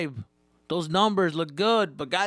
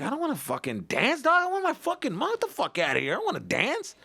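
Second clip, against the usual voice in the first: high, petulant voice of his younger self; lost kid begging for his mom; whiny kid self; 10 semitones higher than usual. Retold or imitated. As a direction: whine like a scared little kid. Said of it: "And I'm just like".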